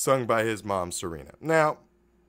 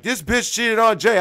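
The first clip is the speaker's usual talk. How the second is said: mock outraged report; 11 semitones higher, 9 dB louder than usual. Telling Jay's friend about cheating, mock outrage. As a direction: imitate a tattletale, high and loud. Voices a man telling on her.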